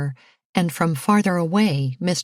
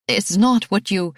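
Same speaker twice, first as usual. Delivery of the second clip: flustered protest; 3 semitones higher, 4 dB louder than usual. A narrator's reading. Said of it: "she stammered".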